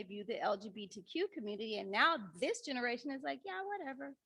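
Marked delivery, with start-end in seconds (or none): 3.4-4.1 put-on dismissive teen. Teens dismissive about older views.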